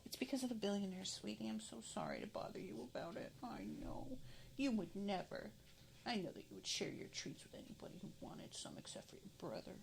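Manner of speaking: soft tender whisper, mostly breath; whispering to the dog. Real, not acted. Whispering to her dog that it's about the billionaire, tender. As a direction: soothe the dog in a hush.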